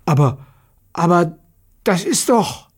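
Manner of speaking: halting, amazed exclamation; astonished. A narrator's reading. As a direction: falter in amazement, pitch climbing. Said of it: "rief erstaunt".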